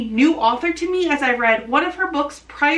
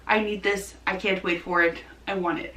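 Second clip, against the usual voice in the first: softer, fading to almost a breath; hushed longing; 5 semitones lower, 6 dB quieter than usual. Real, not acted. Booktuber craving an upcoming book, soft yearning murmur. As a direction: let the wanting sink to a low murmur.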